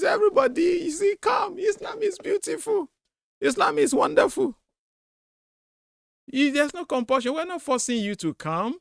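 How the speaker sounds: high-pitched, singsong sales pitch for the faith; mocking falsetto welcome, laughing through it; mock welcoming recruiter. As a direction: coo invitingly in a hammed-up high voice. Mimics recruiters luring people in.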